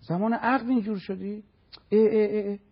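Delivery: high, loud tut of disapproval; mock disgust. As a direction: imitate a scandalized gossip's disgust. Acting out disgusted gossips.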